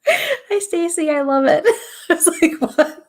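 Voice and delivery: very high, loud delighted greeting; delighted squeal. Real, not acted. Medium greeting her friend Stacy in chat, high delighted squeal. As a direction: squeal hello to a friend, laughing.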